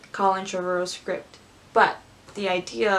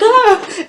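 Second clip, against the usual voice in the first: high, loud comic cry; hammed-up effortful yelp; mock strained scream; 12 semitones higher, 11 dB louder than usual. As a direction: yell as if shoved, jokingly. Rey being pushed.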